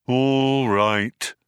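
drawn-out droning assent; put-upon robot monotone; resigned gloomy robot. A narrator's reading. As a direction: give in with a long, level drone.

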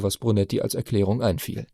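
usual speaking voice

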